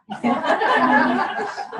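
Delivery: rising laugh; loud amused laugh. Real, not acted. Laughs, dropping the subject.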